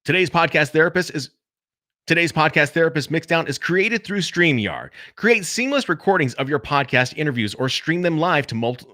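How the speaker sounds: podcast ad read, re-recorded; ad copy read. Shown as a demonstration. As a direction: sell the copy like a promo spot. Rereads ad copy for the edit.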